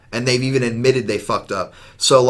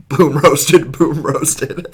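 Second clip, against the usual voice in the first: loud, higher-pitched mock taunt; Trump; 5 semitones higher, 5 dB louder, much slower than usual. Retelling a debate clapback to a co-host, gleeful mock taunt. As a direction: crow a comeback, breaking into a laugh. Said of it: "And Trump's just like".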